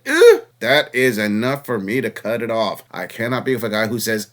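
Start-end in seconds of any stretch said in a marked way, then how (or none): 0.1-0.5 high, loud mock groan of disgust; a hammed-up grunt of distaste; mock disgusted ugh. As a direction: let out an exaggerated high ugh. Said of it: "he said".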